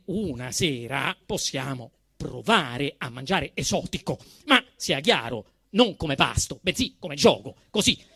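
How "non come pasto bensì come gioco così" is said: ordinary delivery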